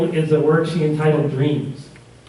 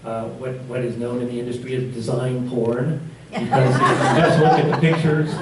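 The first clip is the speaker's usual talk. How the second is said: laughing; 3 semitones lower than usual. Real, not acted. Joking about design porn.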